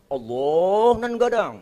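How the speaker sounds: slow, drawn-out Minang takbir; imam orang Padang. Acted. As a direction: stretch it out like an imam leading prayer. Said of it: "maju orang Padang". (takbir panjang ala orang Padang)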